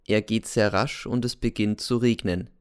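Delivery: ordinary delivery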